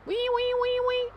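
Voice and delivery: high, loud mock siren wee; siren imitation. Cheering on the firemen with a playful siren. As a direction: wail like a fire siren.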